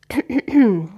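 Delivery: exaggerated throat clearing; preparatory ahem. A narrator's reading. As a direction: clear your throat before singing. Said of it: "Dizzy räusperte sich".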